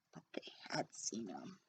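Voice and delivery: hushed secret whisper; whispered aside. A narrator's reading. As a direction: let the listener in on a secret.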